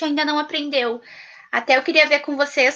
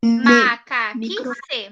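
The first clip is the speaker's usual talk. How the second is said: slow repeat; 3 semitones lower, much slower than usual. Shown as a demonstration. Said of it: "teacher repeats word slowly".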